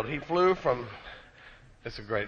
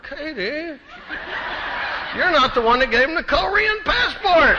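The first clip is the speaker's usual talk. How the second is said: exasperated shout; 10 semitones higher than usual. Retold or imitated. Husband to Katie at customs, shouting in exasperation.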